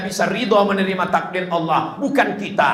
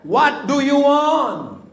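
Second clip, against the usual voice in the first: slow, higher English line in a joking voice; mock English translation; 8 semitones higher, much slower than usual. Preacher jokingly translating God's question, slow and high. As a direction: toss out the English with a grin. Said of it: "Kalau bahasa kampung saya".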